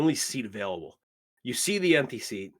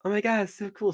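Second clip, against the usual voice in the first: nervous drow gushing; 10 semitones higher than usual. Acted. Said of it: "kinda just like".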